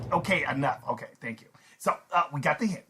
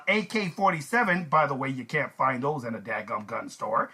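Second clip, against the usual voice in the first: ordinary talk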